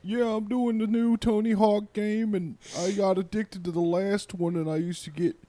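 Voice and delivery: high, slow dopey drawl; Bam impression. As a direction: mimic Bam's dopey slow voice. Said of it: "So Bam was like".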